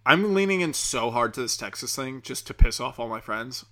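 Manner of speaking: ordinary talk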